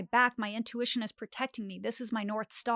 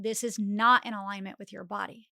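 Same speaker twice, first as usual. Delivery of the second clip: usual speaking voice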